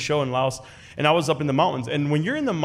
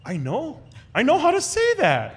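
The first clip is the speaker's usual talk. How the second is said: rising high, thrilled; a sudden burst of confidence; excited realisation; 12 semitones higher than usual. Retold or imitated. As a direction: discover it with glee. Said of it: "I just felt like".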